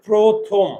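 প্রথম slowly stretched as "proo-thom"; jaw demonstration. Shown as a demonstration. Teacher showing the jaw stays shut, প্রথম slow and rounded. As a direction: draw out both rounded vowels of প্রথম. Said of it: "আমার চোয়াল খুলে না".

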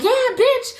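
very high, mocking egging-on voice; jeering hecklers. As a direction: mimic girls goading from the crowd. Imitates women egging it on.

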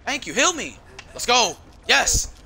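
loud, high hype; real gamer hype after being healed; excited cheer. Real, not acted. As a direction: cheer it like a win. Celebrating getting healed.

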